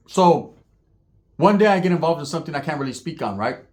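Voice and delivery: normal talking voice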